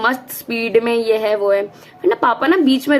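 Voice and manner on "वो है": ordinary delivery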